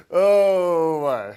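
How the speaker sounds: a big, drawn-out exclamation that falls away; loud exclamation. Real, not acted. Teacher reacting to a student in class, loud exclamation. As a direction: let it burst out loud and high, then drop. Exclaims after bursting out laughing.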